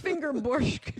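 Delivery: high mock Russian accent; put-on Russian accent; bad Russian accent. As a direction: tag the joke in hokey Russian. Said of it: "this really bad Russian accent".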